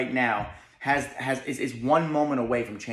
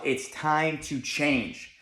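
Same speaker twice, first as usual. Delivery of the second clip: slow mirror words; much slower than usual. Shown as a demonstration. Speaker shows students what to say, very slow. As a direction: lay each word down slowly. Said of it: "you have to say to yourself".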